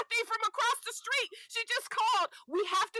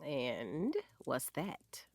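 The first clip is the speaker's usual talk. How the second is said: whisper; 8 dB quieter, much slower than usual. Acted. Intimate whisper to partner.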